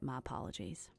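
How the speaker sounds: soft whispered sorry, voice dropping away; whispered apology. Acted. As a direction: hush it out, ashamed. Godric apologizes for his boldness.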